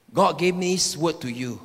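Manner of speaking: ordinary talk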